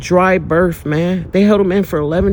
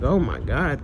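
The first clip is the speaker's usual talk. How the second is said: tearful relief; 4 semitones lower, 7 dB quieter than usual. Retold or imitated. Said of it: "And I just started crying".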